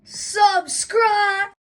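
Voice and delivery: high, loud yell; sudden shouted plug. Pretend. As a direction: shout it out as a comic interruption. Butts in on the sign-off.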